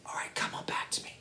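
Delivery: quick, hushed whisper; very soft, airy call back to the class. Shown as a demonstration. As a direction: whisper it briskly, with no voice at all. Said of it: "is our whisper voice".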